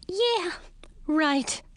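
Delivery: high squeaky scoff; squeaky mouse voice. A narrator's reading. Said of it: "squeaked a voice".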